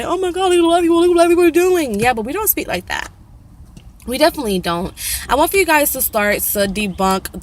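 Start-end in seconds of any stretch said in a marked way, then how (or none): 0.0-2.0 high, fast mock gasp; high fast mocking oh-my-God voice; mock shocked onlooker. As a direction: imitate scandalized outsiders, pitched up. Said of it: "And people say".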